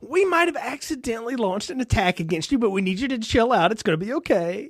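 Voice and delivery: high, apologetic, coaxing phone voice; sheepish president impression. President telling the Russian premier to chill out, sheepish. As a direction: confess the attack gently, pleading calm. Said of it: "I was like".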